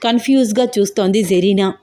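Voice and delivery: plain reading voice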